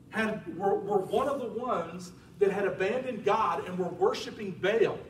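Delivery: ordinary talk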